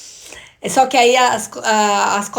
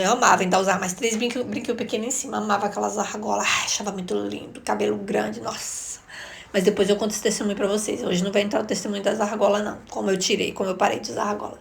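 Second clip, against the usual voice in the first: usual speaking voice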